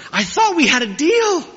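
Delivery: hiss-screech it at the minister in panic; screeching half-whisper. Retold or imitated. Betrayed groom leaning over to the minister, screeching half-whisper. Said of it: "screeches in a kind of whisper".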